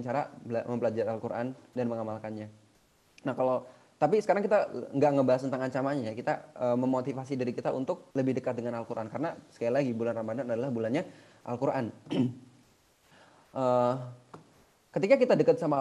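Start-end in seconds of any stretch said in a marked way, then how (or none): none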